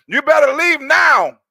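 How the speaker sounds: loud, urgent warning to get out. Retold or imitated. Retelling how his friend's brother loudly warned him to leave, loud urgent warning. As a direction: yell it like a brother ordering him out. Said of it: "his brother said".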